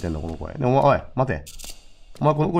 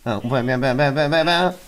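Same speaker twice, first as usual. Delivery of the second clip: mock gunfire; 3 semitones higher, faster than usual. Mock shooting at the boss.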